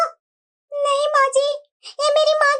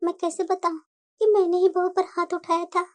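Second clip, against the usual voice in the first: quiet aside; 8 semitones lower, 6 dB quieter than usual. Acted. Mother-in-law's guilty aside to herself.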